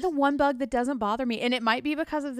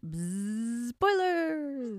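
buzzing like a bee; 4 dB quieter, much slower than usual. Pretend. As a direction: buzz it out, little bee. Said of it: "gonna come in the form of a bee".

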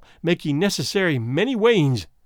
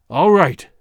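loud outburst; 7 dB louder than usual. Acted. Husband gives in, exploding.

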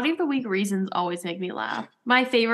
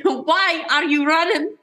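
mock Jack yell; 6 semitones higher, 4 dB louder than usual. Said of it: "It's like".

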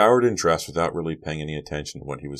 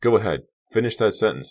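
bitter challenge; 5 semitones higher, 5 dB louder than usual. A narrator's reading. Carrie's angry inner challenge.

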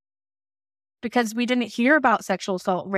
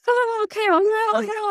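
much higher, frightened whimpering pleas; panicked crying; 13 semitones higher than usual. Retold or imitated. Frightened teen answering the police officer, high tearful panic. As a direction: squeak it out, scared and near tears. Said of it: "I'm, like, crying".

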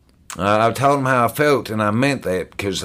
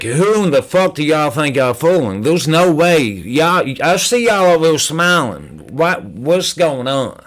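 high, incredulous rant; worked-up outburst; 6 semitones higher, 3 dB louder than usual. Retold or imitated. Newcomer calling out the smiling people at the meeting, worked up. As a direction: challenge them in exasperated disbelief. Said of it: "I may end up getting loud".

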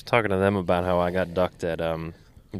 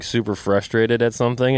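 ordinary talk, nothing marked

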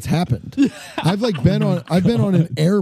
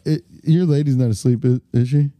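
ordinary talk

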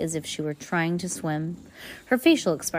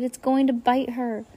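higher fake scream of alarm; pretend scream; 7 semitones higher than usual. A narrator's reading. Klaus faking terror for the adults, mock scream. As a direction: shout the warning, playing scared. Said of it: "Klaus screamed".

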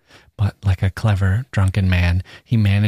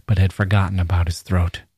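ordinary delivery

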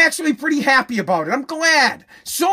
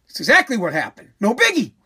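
normal talking voice

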